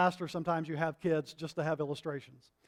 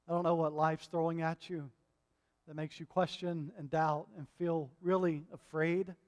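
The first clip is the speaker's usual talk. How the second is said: normal talking voice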